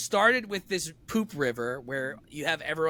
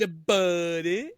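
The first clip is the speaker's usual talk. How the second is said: Pauly Shore drawl; much slower than usual. Retold or imitated. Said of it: "Pauly Shore".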